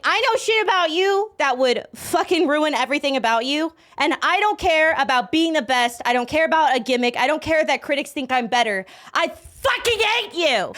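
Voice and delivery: high-pitched imitated tirade, building; mock hateful rant. Acts out rapper's hateful meaning.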